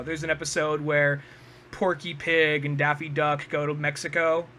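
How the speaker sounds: ordinary talk, nothing marked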